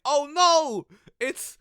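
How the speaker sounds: high, loud fake shock; mock alarm. Commentator faking shock at the boxer, hammed-up and loud. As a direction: ham up the surprise like bad acting. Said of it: "I was just like".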